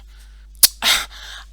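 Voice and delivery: loud huff of annoyance; huff, fed up with the game; exasperated sigh. A narrator's reading. Girl fed up with boy's pretend game, exasperated sigh.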